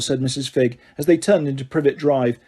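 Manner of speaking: ordinary delivery, nothing marked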